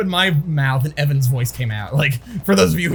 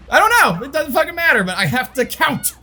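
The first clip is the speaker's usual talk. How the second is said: high, worked-up outburst; frustrated, slowing on the last words; frantic exasperation; 10 semitones higher than usual. Acted. Sufferer acting out losing count in shower, high frantic exasperation.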